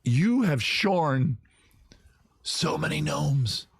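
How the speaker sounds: drawn-out solemn pronouncement; slow dramatic. Acted. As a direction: intone it slowly like a verdict.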